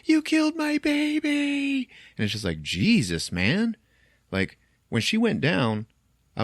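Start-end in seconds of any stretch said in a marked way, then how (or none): 0.1-1.9 high, wailing mother in grief; hammed-up grieving wail; mock hysterical wail. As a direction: cry it out like a broken mother. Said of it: "she's like".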